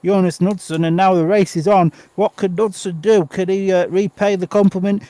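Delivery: rising excited race commentary; live play-by-play. Acted. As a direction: call the race live as it unfolds. Live speedway race call.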